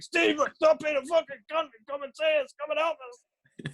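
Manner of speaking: high, wailing shout for help; hammed-up exasperated begging from the next room; retold desperate pleading. Retold pleading yell from toilet.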